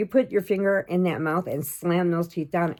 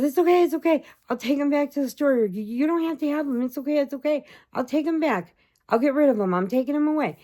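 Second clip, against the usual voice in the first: high, quick, anxious reassurance; dad scrambling to comfort his crying daughter; soothing dad; 9 semitones higher, faster than usual. Retold or imitated. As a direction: hurry to calm a sobbing child. Father soothing crying child, voiced.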